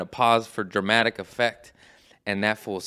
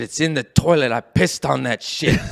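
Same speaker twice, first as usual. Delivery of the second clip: loud, raised-voice comeback; reenacted angry outburst, slowing at the end; defiant retort; 4 semitones higher, 6 dB louder than usual. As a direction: fire it back at the producer, loudly. Acts out defiant reply to producer.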